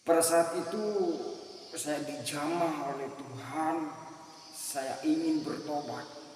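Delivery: ordinary talk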